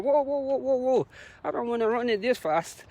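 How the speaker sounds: high, light string of whoas; mock alarm. Runner telling self not to go so fast, mock alarm. Quotes own reaction to running fast.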